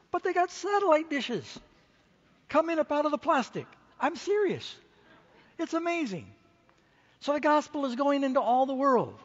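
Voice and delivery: can't believe it, amazed and rising; excited. Real, not acted. Preacher telling the congregation of poor homes with dishes, excited.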